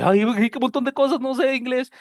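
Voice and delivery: overwhelmed student. Pretend. Teacher mimicking an overwhelmed student. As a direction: play a flustered learner. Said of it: "Usted dice".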